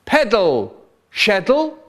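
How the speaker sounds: high, loud call of the name; a shouted command to pedal. A narrator's reading. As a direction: bark it like an order from across the room. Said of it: "so he shouted".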